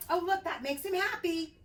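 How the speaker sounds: plain reading voice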